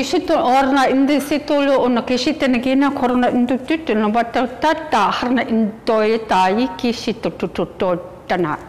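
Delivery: lightly chanted channeled syllables; light language channeling. Acted. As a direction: flow out invented words as if channeling.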